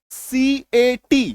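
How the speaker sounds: each letter drawn out slowly; spelled out slowly. Shown as a demonstration. Teacher spelling a word slowly for students. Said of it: "क्या पढ़ते हैं आप इसको?".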